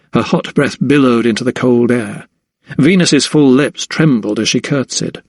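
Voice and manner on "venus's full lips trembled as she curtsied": usual speaking voice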